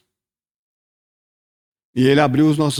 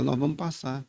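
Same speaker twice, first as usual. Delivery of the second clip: much softer, hushed calm promise; calm quiet assurance; 10 dB quieter than usual. Acted. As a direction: say it softly, as if calming the storm. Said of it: "ele acalma a tempestade e fala". (promessa calma e baixinha)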